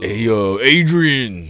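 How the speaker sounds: hammed-up Italian-American call to Adrian; Stallone impression. Friend joins in with a loud mock Rocky call. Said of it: "Sylvester Stallone comes on there and be like".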